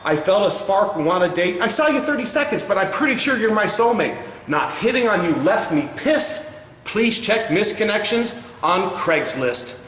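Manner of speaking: hammed-up romantic card reading; greeting card verse. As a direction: read it like tender poetry. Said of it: "card even tells the girl".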